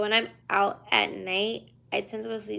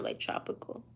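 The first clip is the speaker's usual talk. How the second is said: whispering; 10 dB quieter than usual. Real, not acted. Trailing whispered word.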